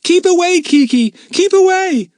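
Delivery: put-on very high, loud boy's yell. A narrator's reading. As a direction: shout an urgent warning, full voice. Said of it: "yelled Jack".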